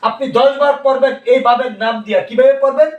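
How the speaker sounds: ordinary delivery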